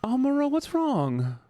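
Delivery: very high, drawn-out cooing worry; high-pitched concern. Real, not acted. Consoling an upset chatter.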